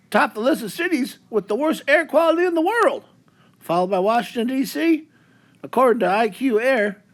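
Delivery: hammed-up Canadian newsreader, eh; a lightly put-on Canadian accent reading the news. Mock Canadian newscaster bit.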